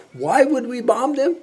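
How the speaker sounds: high-pitched disbelief, rising at the end; incredulous question. Acted. General baffled by a senator's war call, high incredulous question. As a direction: ask it high, as if utterly baffled.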